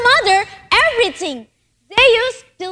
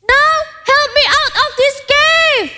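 higher, urgent call for help; 6 semitones higher than usual. A narrator's reading. Aladdin calls from inside the cave.

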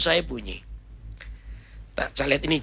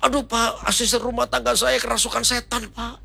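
high-pitched, distressed report; acted fright, pleading down the phone; panicked caller; 9 semitones higher than usual. Congregant tells pastor the maid is possessed, panicked.

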